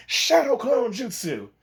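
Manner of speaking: high, slow technique call; jutsu call. Retold or imitated. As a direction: call out the move name. Calling out the technique.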